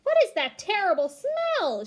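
high, loud cry of disgust; cried out. A narrator's reading. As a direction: shriek it out at the stink. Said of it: "she cried".

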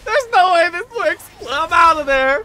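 loud, high incredulous shouting; laughing disbelief. Real, not acted. Laughing at a risky escape.

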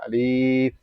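আলিফ with a long, held li; wrongly drawn-out Alif. Shown as a demonstration. Teacher imitates those who stretch it, long-held আলিফ. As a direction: drag out the last syllable of আলিফ. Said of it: "অনেকে টানি, অনেকে টানি না".

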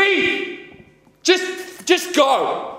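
very loud, high command to leave; ordering a partner out in rage; furious shout. Acted. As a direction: scream at her to get out. Throwing partner out.

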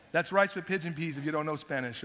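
normal talking voice

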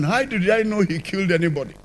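ordinary delivery

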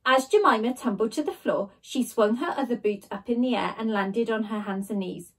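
usual speaking voice

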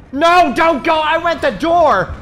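loud, high, stretched-out yell; panicked shouting. Real, not acted. Player watching a move go wrong, panicked yelling. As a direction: yell in sudden alarm.

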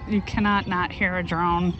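ordinary talk

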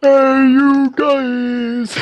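hammed-up slow call, high and loud; drawn-out bellow. Friend calling out to the gaming group in a drawn-out wail. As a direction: stretch the words into a wailing shout. Goofy shouted movie-quote callout.

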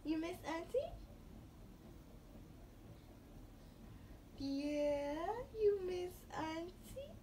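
high, slow cooing to a newborn. Real, not acted. Aunt cooing to baby.

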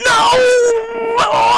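high, loud despairing no; anguished shout. Real, not acted. Gamer shouting as the character dies. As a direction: wail it as you die.

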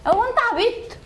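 high-pitched exasperated challenge; indignant. Real, not acted. Customer rebukes rude barber, high-pitched and indignant. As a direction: snap it out in disbelief.

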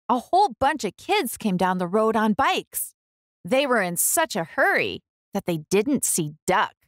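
plain reading voice

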